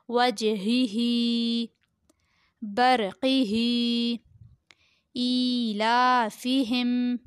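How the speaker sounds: slow recitation with long-held vowels; Qaida word recitation. Shown as a demonstration. Teacher reading Qaida words to pupils, slow recitation. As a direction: draw each word out evenly.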